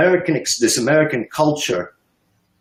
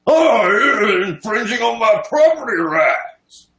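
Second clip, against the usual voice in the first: high, loud, slow sneering cry; mock whiny owner; 9 semitones higher, 4 dB louder, slower than usual. Mocking a property owner who refuses requisitions, high loud whine. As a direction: ham up a whining property owner. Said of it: "Sounds like one of those freaks say".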